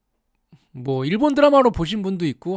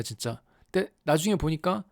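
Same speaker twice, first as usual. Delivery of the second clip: ordinary talk, nothing marked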